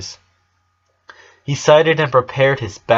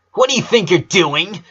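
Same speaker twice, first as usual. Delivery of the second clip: angry yell; 7 semitones higher than usual. Acted. Lynn scolds Lucy for scaring him, angry yell. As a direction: tear into her. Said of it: "she yelled at Lucy".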